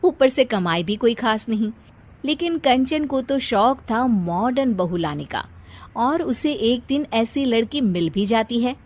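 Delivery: usual speaking voice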